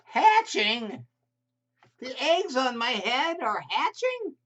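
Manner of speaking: high, loud disbelieving Gerald; horrified the eggs are hatching; alarmed elephant. Acted. Gerald reacting to eggs hatching on his head, alarmed.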